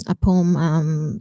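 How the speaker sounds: normal talking voice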